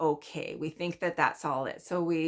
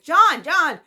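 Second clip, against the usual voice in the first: lightly voiced worried neighbor, higher and louder; neighbor characterization; 9 semitones higher, 8 dB louder than usual. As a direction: play the alarmed neighbor calling out the smoke. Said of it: "I'll try to do a little characterization".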